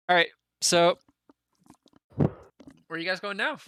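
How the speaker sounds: usual speaking voice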